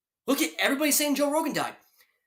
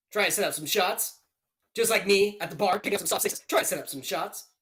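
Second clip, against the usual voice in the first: play-by-play commentary; 3 semitones lower than usual. Acted. Streamer calls the fight.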